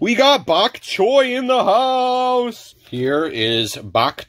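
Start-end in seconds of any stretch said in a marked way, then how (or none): 0.0-2.8 high, hugely stretched announcement; drawn-out high-pitched hollering; hype shout. Real, not acted. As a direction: belt it out like a hype man. Startling hype announcement of a card.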